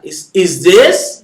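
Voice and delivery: is this slowly, rising at the end; is this, pitch lifting on this; question rise. Shown as a demonstration. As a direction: show the question tune on is this. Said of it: "أهذا".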